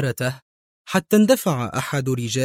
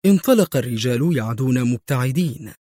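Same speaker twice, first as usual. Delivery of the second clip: plain reading voice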